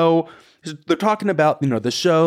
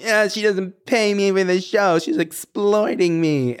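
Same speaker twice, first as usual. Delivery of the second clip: high, sulky mock complaint; imitating Sonia; 8 semitones higher than usual. Retelling Sonia's joke about not being paid, in a whiny voice. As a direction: whine it like a joking diva. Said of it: "Sonia's like".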